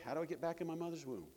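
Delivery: imitating a confused Nicodemus; mock Nicodemus. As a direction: rush the baffled question like Nicodemus. Mocking Nicodemus' confused question.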